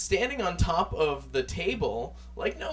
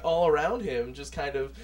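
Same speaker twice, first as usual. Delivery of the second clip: ordinary delivery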